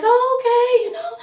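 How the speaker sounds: high, sugary mock-polite voice; mock sweet greeting. Mimicking [name] greeting [name] in a high sweet voice. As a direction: squeak it out like a fake-nice greeting.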